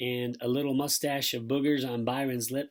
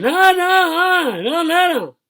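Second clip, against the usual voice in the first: very high, loud muffled wail; frantic high moaning; panicked mumbling; 15 dB louder, slower than usual. A narrator's reading. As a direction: wail through stuck lips. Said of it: "and mumbling".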